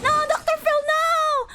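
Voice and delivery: high, hammed-up wail of no; mock anguished cry. Reacting to Doctor Phil's death, mock anguished cry. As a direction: cry out as if watching a friend fall.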